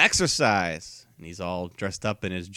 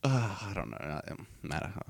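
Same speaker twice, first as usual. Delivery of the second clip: whispered, unsure mumble; 2 semitones lower, 11 dB quieter than usual. Real, not acted. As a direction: breathe it out unsure.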